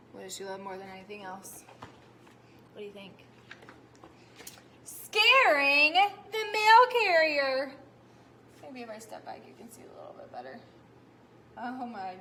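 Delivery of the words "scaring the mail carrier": loud, higher, slow reveal; teacher revealing the cow's favorite thing; big reveal. Acted. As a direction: drag it out for suspense. Reveal read to children.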